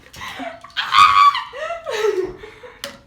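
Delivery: long, high, loud laughing shriek. Real, not acted. Woman overjoyed at her positive test, shrieking laugh. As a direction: let out a squealing laugh.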